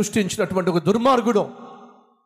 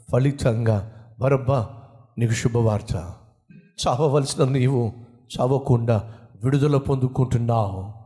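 the jailer's voice, lowered and gentle, breaking good news; jailer bringing good news; 7 semitones lower than usual. Acted. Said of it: "చెరసాల అధిపతి అని ఉంటాడు".

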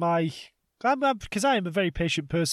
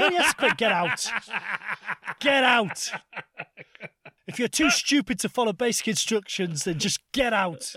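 angry teacher; 4 semitones higher than usual. Retold or imitated. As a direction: throw the cheeky pupil out of class. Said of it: "teacher throwing pupil out".

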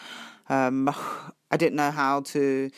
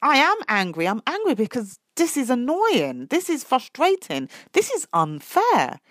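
raised, indignant inner outburst; pent-up anger finally voiced; angry inner voice; 8 semitones higher, 4 dB louder than usual. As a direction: let the frustration out, higher and louder. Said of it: "there's a part of me that's like".

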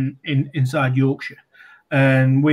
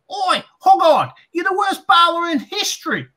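heckling fan. Retold or imitated. Fan mocking the bowler from the crowd, high jeering yell. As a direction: yell it down from the stands.

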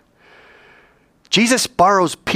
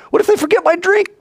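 high, quick protest; objecting student; 4 dB louder than usual. Acted. Student objecting to Mister Dean, high quick protest. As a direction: push back with a worried what-if.

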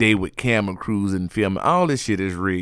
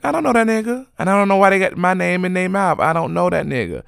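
insistent denial, pitched high and punchy; the woman at the party flatly denying any affair, adamant; 9 semitones higher, faster than usual. Retold or imitated. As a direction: deny it hard, no room for doubt. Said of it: "like, very adamant".

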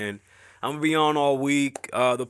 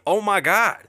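higher, rising mock panic; retold alarm; 7 semitones higher, 3 dB louder than usual. Husband recalling his wife's wake-up call, higher mock panic. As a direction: sell the shock of realizing. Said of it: "to really go".

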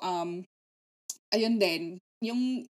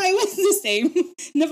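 laughing; 10 semitones higher, 9 dB louder than usual. Real, not acted. Laughing agreement, repeated.

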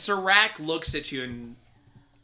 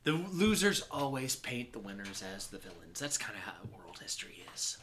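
sighing villain; 3 semitones lower, 8 dB quieter than usual. Acted. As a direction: sigh then soft, whispered end. Villain shrugs off insults.

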